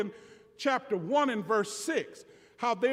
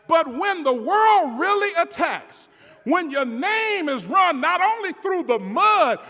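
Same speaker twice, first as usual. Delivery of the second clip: raised, louder, impassioned sermon voice; full-force preaching; 6 semitones higher, 7 dB louder than usual. Real, not acted. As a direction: preach it hard, building to a loud, high challenge.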